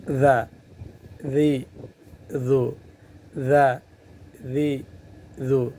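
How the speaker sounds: ذا ذی ذو with the tongue tip between the teeth; ذال کی مشق; Arabic dhal drill. Shown as a demonstration. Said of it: "زبان کا جو سرا ہے".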